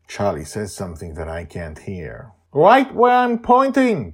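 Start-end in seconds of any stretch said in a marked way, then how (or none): 2.5-4.1 high, loud excited shout. Acted. Joy shouting to Charlie from the condo, high and loud. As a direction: call out from the flying condo. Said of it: "Joy shouts".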